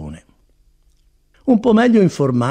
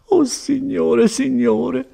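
high, slow wail of dismay; acted tearful exclamation, drawn out high; almost crying; 13 semitones higher, much slower than usual. A narrator's reading. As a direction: lament as if close to tears. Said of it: "esclamò di nuovo Agnese, quasi piangendo".

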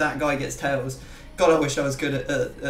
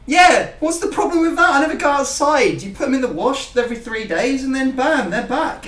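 higher and louder, fired up in his own defence; mock outrage, louder and high; defensive outburst; 8 semitones higher, 7 dB louder than usual. Real, not acted. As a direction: push back hard and loud, playfully indignant. Defending only having three shirts.

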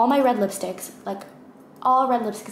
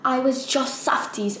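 higher-pitched attempt at a British accent; 4 semitones higher than usual. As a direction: imitate the quote with an accidental British accent. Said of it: "why am I saying it in a British accent?".

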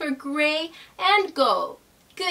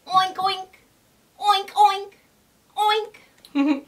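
pig oinks; 5 semitones higher, slower than usual. Shown as a demonstration. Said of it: "what sound a pig makes".

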